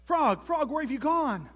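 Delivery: high, loud calls; panicked toad crying out; frantic searching. A narrator's reading. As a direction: call desperately into the dark. Toad searches for vanished friend.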